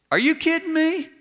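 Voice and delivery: high, disbelieving cry; exasperated disbelief at the crowd; incredulous. Real, not acted. Preacher's incredulous outburst.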